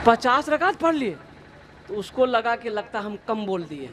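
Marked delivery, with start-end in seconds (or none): 0.1-1.2 raised-pitch retold disbelief; mock astonishment. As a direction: act out a host's mild amazement at the boast. Host doubts guest's absurd claim. (हैरानी की हल्की नक़ल, ऊँचे सुर में)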